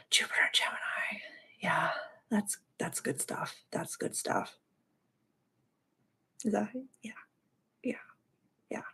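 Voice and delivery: hushed, breathy whisper; barely whisper, moved; soft emotional whisper. Real, not acted.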